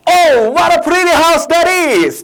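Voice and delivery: high, loud, drawn-out cry of admiration; exclaiming. Acted. Grown-ups admire the pretty house, exclaiming. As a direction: gush in hammed-up amazement, every word stretched. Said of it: "그럼 exclaim 뭐 할까?".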